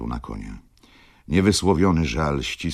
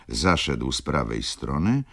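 usual speaking voice

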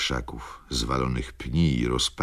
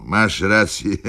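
louder, then laughing it off; still laughing; 5 semitones higher, 5 dB louder than usual. A narrator's reading. Tipsy Wokulski laughing, conceding to Rzecki. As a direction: laugh through the admission. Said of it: "Wokulski wciąż się śmiał".

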